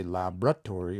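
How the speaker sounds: laboratory as lab-RA-tory; wrong stress on second syllable. Shown as a demonstration. Teacher shows what you don't say, laboratory stressed mid-word. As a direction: shift the stress of laboratory onto the middle, RA. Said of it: "You don't say".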